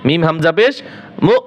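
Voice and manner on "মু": "মু" cut short with a glottal push; a short, pushed "mu'" for মু; jolted stop. Shown as a demonstration. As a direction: stop মু abruptly, without stretching it. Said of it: "ধাক্কা দিবেন".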